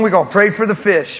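grinning punchline through laughter; laughing. Real, not acted. Pastor joking to host about the fish, laughing.

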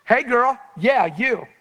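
high, loud holler; yelling across distance. Retold or imitated. Preacher hailing a girl across the mall, yelling. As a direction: call out to get a stranger's attention. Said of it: "I yelled across the mall".

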